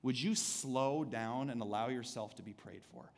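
soft, slowing invitation; gentle quiet voice of God. Retold or imitated. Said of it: "God says".

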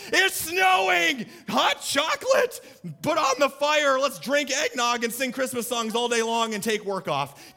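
high, loud squealing joy; over-the-top thrilled shout; giddy excitement. Retold or imitated. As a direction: shriek it like a kid seeing snow. Excited snow-lover meme voice.

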